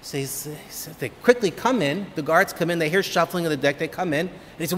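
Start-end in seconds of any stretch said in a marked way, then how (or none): none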